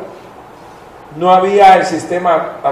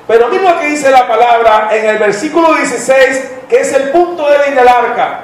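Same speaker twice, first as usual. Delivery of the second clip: raised, slower preaching voice, lifted high; 6 semitones higher than usual. Acted. Pastor reading Genesis to the church, raised preaching voice. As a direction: preach it out to the congregation.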